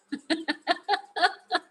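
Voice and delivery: a sudden high laugh at herself; high burst of laughter. Real, not acted. Laughing at her own silly scolding of her daughter, high laugh.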